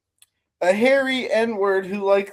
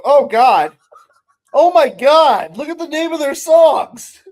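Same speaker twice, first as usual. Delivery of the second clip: real loud, high-pitched disbelief; shocked outcry; 7 semitones higher, 10 dB louder than usual. Real, not acted. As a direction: blurt it out, appalled and loud. Shocked on seeing the song titles.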